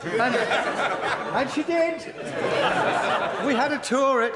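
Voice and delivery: can barely get the story out for laughing; laughing while telling. Real, not acted. MP laughing through his story of a Number Ten visit. Retelling funny Thatcher anecdote, laughing.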